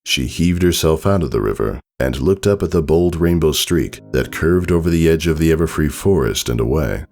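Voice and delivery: ordinary delivery, nothing marked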